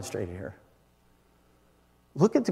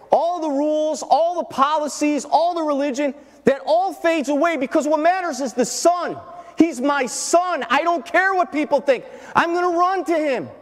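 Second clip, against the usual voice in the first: high, loud sermon climax; full-force preaching; 13 semitones higher, 4 dB louder than usual. Acted. Preacher voicing a father who runs to his son, full-force preaching. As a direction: build to a preacher's high-pitched shout.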